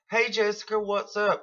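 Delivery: a fake-friendly chirp, like a best friend. Sister calling to fake friendship, sugary high greeting. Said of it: "Acting like she's my best fucking friend".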